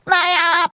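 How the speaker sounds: high, short goofy giggle; rippling cartoon chuckle; laugh at own pun.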